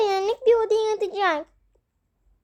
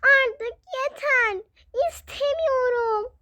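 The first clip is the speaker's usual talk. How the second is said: higher, slow tearful plea; crying fed up; 6 semitones higher, slower than usual. Acted. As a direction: sob it out, slow and high. (ağlayarak, yavaş ve tiz)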